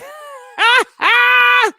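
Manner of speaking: high, loud burst of laughter. Real, not acted. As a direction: burst out laughing at your own act. Laughs after man voice demo.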